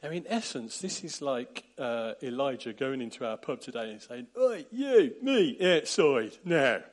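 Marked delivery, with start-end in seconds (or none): 4.4-6.8 loud, slow pub-brawl call; pub challenge. Retold or imitated. Elijah calling someone out of the pub, loud and slow. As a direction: pick a fight like a lad outside the pub. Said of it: "He was kind of picking a fight".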